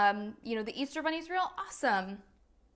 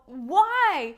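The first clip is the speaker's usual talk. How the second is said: higher, louder mock despair; exasperated cry of why; 7 semitones higher, 10 dB louder than usual. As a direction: throw up your hands and wail the why. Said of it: "He's like".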